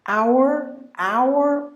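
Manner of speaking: high, rhotic OW-er for "Hour"; American pronunciation. Shown as a demonstration. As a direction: sound the final R in "Hour". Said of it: "The R is also pronounced".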